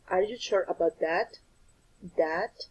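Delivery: "that" rising at the end, then repeated with a rise; teacher's rising-tune example on "that"; rising intonation. Shown as a demonstration. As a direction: demonstrate a yes-no question, lifting the pitch on "that". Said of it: "use rising intonation".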